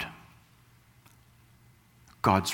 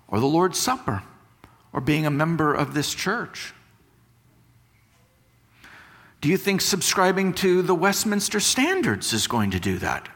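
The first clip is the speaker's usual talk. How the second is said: higher, pressing rhetorical questions; fervent preaching; 8 semitones higher than usual. Acted. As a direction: challenge the congregation, pushing each question up. Preaching at full force, rhetorical questions.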